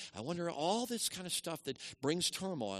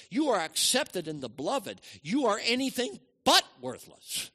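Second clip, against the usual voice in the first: rising, louder and higher, each promise hammered home; preaching at full force; 6 semitones higher, 6 dB louder than usual. Acted. Preacher assures the worthless listener he is loved, at full force. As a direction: preach it out, building to a shout.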